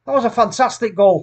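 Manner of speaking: high, loud praise; excited. Real, not acted. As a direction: rave about the goal. Praising disallowed goal.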